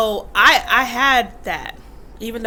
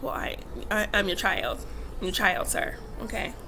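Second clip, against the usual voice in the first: much softer, half-whispered sheepish answer; meek reply; 3 semitones lower, 7 dB quieter than usual. Retold or imitated. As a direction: mumble it back like a cowed kid. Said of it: "I was like".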